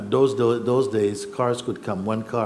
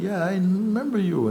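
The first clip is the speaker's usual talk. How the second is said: excited insistence; 9 semitones higher than usual. Retold or imitated.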